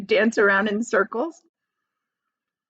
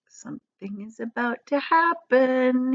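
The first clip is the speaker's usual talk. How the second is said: to himself; 5 semitones higher than usual. Acted. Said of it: "he told himself".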